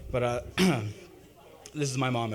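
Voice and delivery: ordinary talk, nothing marked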